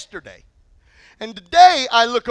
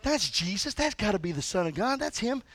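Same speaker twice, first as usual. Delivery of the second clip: hushed disciple; 3 semitones lower, 9 dB quieter than usual. Retold or imitated. Disciple whispering beside Jesus.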